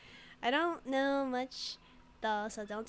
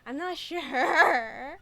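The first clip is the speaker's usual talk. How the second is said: laughing; 4 semitones higher, 10 dB louder, much slower than usual. Real, not acted. Laughing at own bit.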